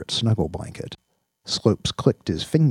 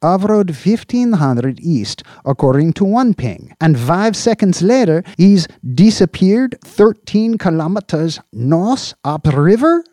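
high, loud, pushy boss voice; a boss grilling his subordinate; Slope, demanding boss; 12 semitones higher, 11 dB louder than usual. Acted. Police chief grilling an officer over missing agents, demanding.

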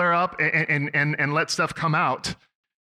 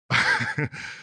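short, breathy chuckle; guilty laugh. Real, not acted. Dad laughing at his daughter's mishap, guilty laugh. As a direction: let out a real, brief chuckle. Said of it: "I'm laughing 'cause I'm partially sick".

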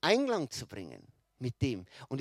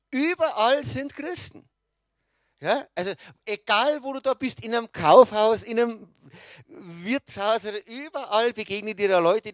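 higher and louder, carried away with joy; excited; 5 semitones higher, 8 dB louder than usual. Real, not acted. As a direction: gush about Redding, full of delight. Enthused about Christians in Redding. (begeistert, höher und lauter)

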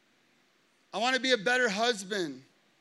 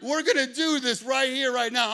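louder, higher retold dare; mock loud challenge; 4 semitones higher, 6 dB louder than usual. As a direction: shout back the other driver's challenge. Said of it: "he looks at me like".

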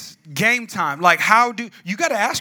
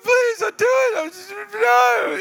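very high, wailing plea; mock bawling; 12 semitones higher, 4 dB louder than usual. Teen begging the officer, mock bawling. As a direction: bawl it like a baby, hammed up. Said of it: "I was crying".

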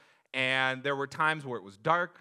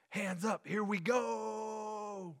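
higher, slow drawn-out here we go; confident kid; 7 semitones higher, 5 dB quieter, much slower than usual. Retold or imitated. Maverick cheering on the coaster, higher drawn-out call. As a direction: savor the launch. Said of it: "But he's so confident".

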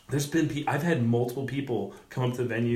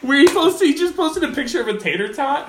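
usual speaking voice

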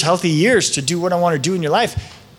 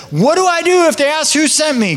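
higher, worried questioning voice; anxious Moses; 8 semitones higher than usual. Retold or imitated. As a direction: fret like a nervous Moses. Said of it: "Moses says".

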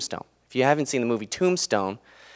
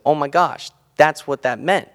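higher, louder retold amazement; sudden realization; 6 semitones higher, 8 dB louder than usual. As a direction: relive the moment it finally clicked.

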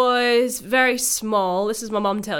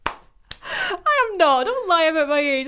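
mock outrage; 9 semitones higher, 3 dB louder, slower than usual. Mock indignant denial.

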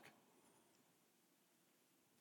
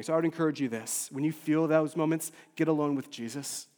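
ordinary talk, nothing marked

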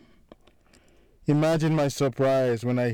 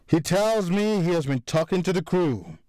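rising anger; 6 semitones higher than usual. A narrator's reading. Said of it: "his voice getting louder".

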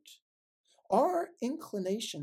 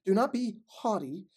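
measured, solemn Bible reading; scripture reading. Acted. Preacher reading Romans to the congregation, solemn. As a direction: read the verses with weight and pauses.

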